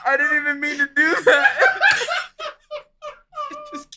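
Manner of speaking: high, squeaky-with-laughter excuse; laughing. Real, not acted. Explaining to friends his accidental accent, laughing helplessly. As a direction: barely get it out through giggles.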